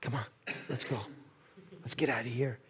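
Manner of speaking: quiet, low urgent coaxing; hushed urgent whisper-like plea; hushed rescuer. Retold or imitated. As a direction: coax him out softly. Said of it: "and he's like".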